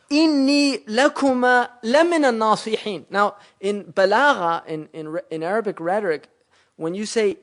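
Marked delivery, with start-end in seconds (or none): 0.1-3.1 Quranic recitation. Shown as a demonstration. Quranic verse recited in tafsir.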